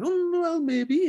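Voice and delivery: high, coy mock reply; coy high Sherry.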